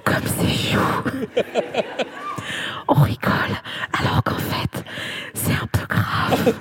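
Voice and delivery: chuchoté, presque sans voix; lâche ça en aparté, tout bas; whisper. Real, not acted. Confidence murmured aside. (murmure complice, presque inaudible)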